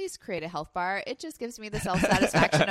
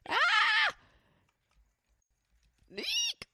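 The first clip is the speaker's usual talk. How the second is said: startled shriek. Real, not acted. Startled by monster attack.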